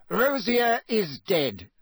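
stern official. A narrator's reading. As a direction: interrogate crisply. Crouch stating coldly.

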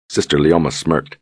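ordinary delivery

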